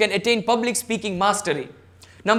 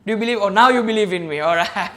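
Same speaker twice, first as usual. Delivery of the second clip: usual speaking voice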